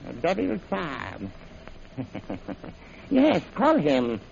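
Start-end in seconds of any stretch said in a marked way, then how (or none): 2.0-2.7 low, soft sinister chuckle; evil low laugh; villain chuckle. Acted. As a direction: gloat quietly. Villain gloating.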